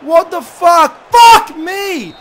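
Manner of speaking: loud, high outraged yelling at the screen; furious shout. Real, not acted. Gamer yelling at his football match, furious shout. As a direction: blast it out in real disbelief.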